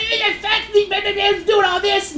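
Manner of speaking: high-pitched mock complaining mom; mocking angry mom. The mom complaining to the owner, high mock whine. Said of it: "He's like".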